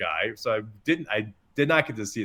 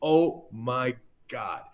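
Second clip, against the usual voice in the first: drawn-out, word-by-word amazement; awestruck inner voice; 2 semitones higher, much slower than usual. Retold or imitated. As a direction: stretch each word in stunned wonder. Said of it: "My mind just went".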